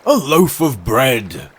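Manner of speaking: loud, slow pronouncement; stately walrus. Acted. Walrus announcing the meal, ponderous. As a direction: declare it slowly and fully.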